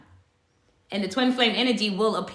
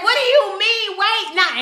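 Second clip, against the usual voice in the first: surprised protest; 10 semitones higher, 4 dB louder than usual. Retold or imitated. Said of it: "I was just like".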